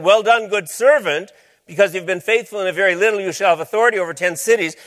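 master praising servant. Retold or imitated. Master in the parable speaks.

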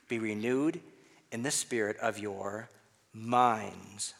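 measured phrases, then "minds" alone after a pause; stress on minds. Shown as a demonstration. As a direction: pause before "minds" and stretch it. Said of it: "emphasize some other words".

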